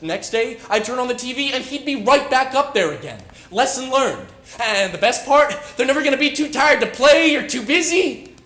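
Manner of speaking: building excited rave. Acted. Raving about beloved television.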